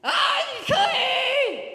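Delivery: high, drawn-out full-volume shout; a leper's loud warning cry, held long; yelled. Acted. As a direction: yell it out to the whole town. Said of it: "look at all of them and yell".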